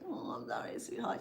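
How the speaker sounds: hushed, breathy sorry; soft whispered sympathy. Retold or imitated. Consoling someone over the lost rubies, in a soft whisper.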